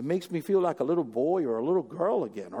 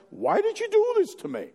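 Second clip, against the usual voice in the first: much higher, put-on wounded voice; mock whiny accusation; 4 dB louder than usual. Spouse blaming the other in a fight, mock high whine. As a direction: whine it like the hurt party. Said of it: "you ask".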